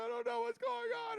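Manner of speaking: high, wailing sob-voice from a crying scene; acted crying. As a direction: wail it out as the audition cry. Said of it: "we do the crying scene".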